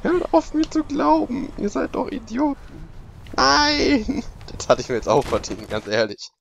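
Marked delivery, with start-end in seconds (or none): 0.0-2.9 much higher, louder mock outburst; hammed-up high-pitched scolding; mock high shriek. 3.4-4.2 a high loud Nein; high loud real dismay; high dismayed cry. Real, not acted. Dismayed cry at game failure.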